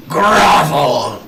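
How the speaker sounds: loud, commanding bark; commanding shout. Acted. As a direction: order it like a master. Undead character casting command.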